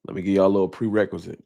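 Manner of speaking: ordinary talk, nothing marked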